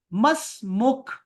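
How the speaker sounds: मसमुख drawn out slowly, final sound held back; careful pronunciation. Shown as a demonstration. Teacher drills the word for the class. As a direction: stretch मसमुख syllable by syllable. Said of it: "आपने कहना है मसमुख".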